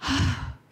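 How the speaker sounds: a weary exasperated sigh; daughter's wordless sigh at her mother; imitated sigh. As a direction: breathe out like a fed-up teenager. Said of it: "cuman menghembuskan nafas".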